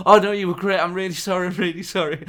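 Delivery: high-pitched imitated flustered apology; embarrassed gushing apology. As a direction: gush apologetically, hammed up and high. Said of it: "she was so embarrassed".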